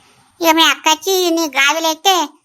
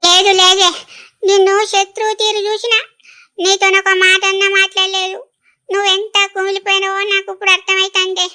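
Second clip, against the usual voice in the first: high, crying wail of remorse; tearful apology; 4 semitones higher than usual. Acted. Elder sister begging forgiveness of younger, crying. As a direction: plead through tears, pitched high. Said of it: "ఏడవకులే".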